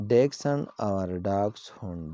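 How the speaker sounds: Dachshund said slowly, first DEK-sun then DAKS-hun; both pronunciations, slow. Shown as a demonstration. Teacher slowly contrasts both Dachshund readings for viewers. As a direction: set the two Dachshund readings side by side slowly. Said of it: "This is the pronunciation of this word".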